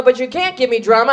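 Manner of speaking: normal talking voice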